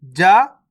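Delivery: जा said simply, no puff of air; plain unaspirated. Shown as a demonstration. Teacher shows learners the simple letter, जा unaspirated. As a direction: say जा with a plain, unforced consonant. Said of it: "जा को simple पढ़ेंगे".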